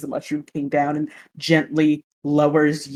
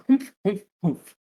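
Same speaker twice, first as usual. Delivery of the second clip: grumpy humph; 3 semitones higher than usual. Retold or imitated. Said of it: "make the noise as well".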